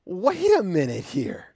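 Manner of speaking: high, loud incredulous objection; indignant protest. Retold or imitated. Empathetic listener protests to God, high and indignant. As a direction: protest like it's outrageously unfair. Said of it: "you look at this and go".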